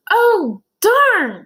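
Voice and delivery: high, loud wail of dismay; disappointed child Joe. Acted. Boy realises he missed recess, high dismayed wail.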